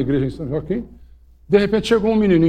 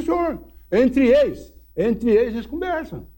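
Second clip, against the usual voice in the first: menino caipira; 7 semitones higher than usual. Retold or imitated. Menino responde ao padre.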